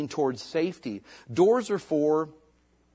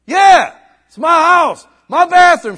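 mock defiant yell; 19 dB louder, slower than usual. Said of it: "And I was like".

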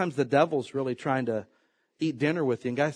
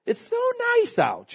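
mock cheery. Mocking sunny weather show.

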